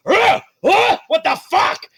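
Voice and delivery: high, loud panicked villain; panicked yelps. Acted. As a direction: yelp at blades striking near the feet. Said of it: "He's like".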